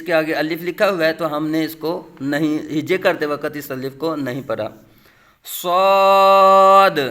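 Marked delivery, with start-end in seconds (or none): 5.6-7.0 long, loud, heavy صاد with a whistling s; full-mouthed whistling Saad. Shown as a demonstration. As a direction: make صاد thick and hold it steady. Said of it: "پر یعنی موٹا کر کے پڑھیں گے".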